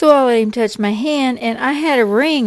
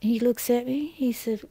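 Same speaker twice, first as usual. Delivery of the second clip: ordinary delivery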